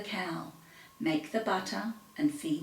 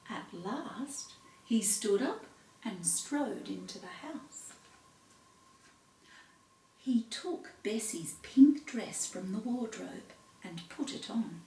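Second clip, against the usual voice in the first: usual speaking voice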